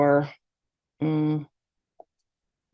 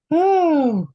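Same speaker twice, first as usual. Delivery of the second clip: high sigh sliding down; audible sigh; 12 semitones higher, 8 dB louder than usual. Real, not acted. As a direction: let out a big, loud falling exhale. Exhaling after group deep breaths.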